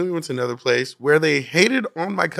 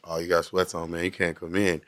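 low, softer mock of a dismissive bouncer; 9 semitones lower, 9 dB quieter than usual. Retelling bouncers turning his cousin away, low mock voice. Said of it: "Everywhere we went".

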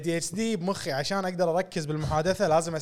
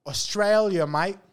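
Australian accent; 4 semitones higher, much slower than usual. Shown as a demonstration. Host showing off an Australian accent: hammed-up Aussie. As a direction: greet like a cheery Aussie bloke. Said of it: "this is a British, uh, accent".

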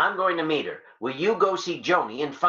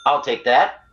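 ordinary talk

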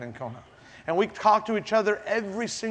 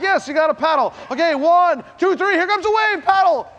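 higher, louder count-in call; 10 semitones higher, 5 dB louder than usual. Retold or imitated. Surf coach calling John to paddle, loud high count. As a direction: shout the count across the water.

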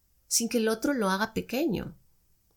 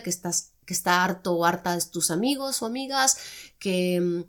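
ordinary delivery

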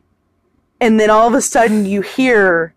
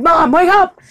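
mild imitated yell, higher pitch; retold cry to wake mom, raised; Peter; 8 semitones higher, 4 dB louder than usual. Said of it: "he's like".

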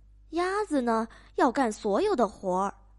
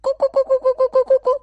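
very fast, high, loud clucks; 母鸡飞快高声咕咕叫; frantic hen clucking; 11 semitones higher, 10 dB louder, much faster than usual. Acted. Hen chases farmer.

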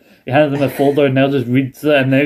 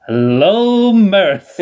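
high, loud, gleeful greeting; triumphant crowing hello; gloating hello; 7 semitones higher, 3 dB louder, much slower than usual. Retold or imitated. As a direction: sing it out like you've just won. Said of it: "he goes".

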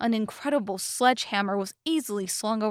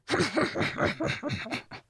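dim-witted higher chuckle; thoughtless giant chuckle; 7 semitones higher than usual. A narrator's reading. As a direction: chuckle vacantly as the oafish giant. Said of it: "chuckled thoughtlessly in response".

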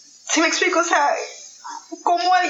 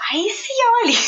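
retold panic in a much higher voice; high-pitched alarm; 11 semitones higher, faster than usual. Same thought repeated, pitched high.

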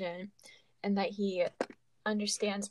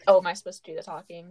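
usual speaking voice

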